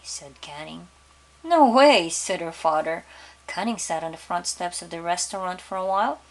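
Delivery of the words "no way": firm no. Acted. Father refuses daughter's trip.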